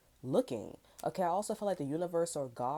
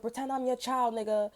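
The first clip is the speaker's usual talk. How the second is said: retold angry yell; 7 semitones higher, 6 dB louder than usual. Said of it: "I was like".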